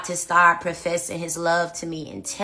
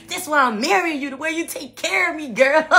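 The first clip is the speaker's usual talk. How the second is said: gangster fiancé; 8 semitones higher than usual. Retold or imitated. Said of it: "he was really gangster".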